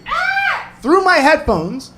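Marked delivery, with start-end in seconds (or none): 0.1-0.7 short, very high-pitched shriek; woman's scream. Retold or imitated. Woman screaming at an accident, imitated high shriek. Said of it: "I heard a woman scream".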